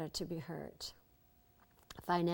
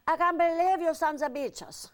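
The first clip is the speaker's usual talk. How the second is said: put-on high, loud furious accented outburst; Eva furious; 10 semitones higher, 8 dB louder than usual. Eva yelling at the crew in the bar, furious and loud. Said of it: "she was furious".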